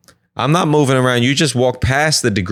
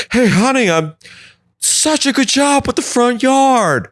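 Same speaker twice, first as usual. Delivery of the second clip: high, sweet husband voice, much higher; doting husband; 10 semitones higher than usual. Acted. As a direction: gush like an oblivious husband admiring the yard. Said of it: "I was like".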